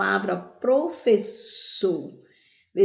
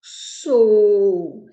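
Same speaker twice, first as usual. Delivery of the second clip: "Sou" loud and drawn out; strong syllable alone; 9 dB louder than usual. Shown as a demonstration. Teacher answering which syllable is strongest, "Sou" loud and held. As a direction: push out "Sou" long and strong. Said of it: "qual é a sílaba mais forte?".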